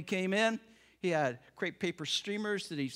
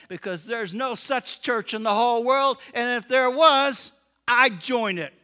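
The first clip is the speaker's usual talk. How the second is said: emphatic declaration; 6 semitones higher, 11 dB louder than usual. Acted. Diner man declares enthusiastically.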